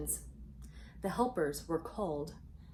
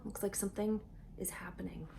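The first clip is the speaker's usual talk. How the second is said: hushed suspense whisper; breathy storytime whisper, a bit quick; 6 dB quieter, faster than usual. Acted. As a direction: lean in and whisper it like a secret.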